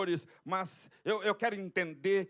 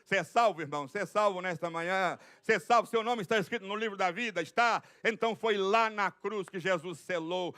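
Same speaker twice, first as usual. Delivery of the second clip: ordinary talk, nothing marked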